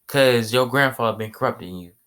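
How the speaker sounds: ordinary delivery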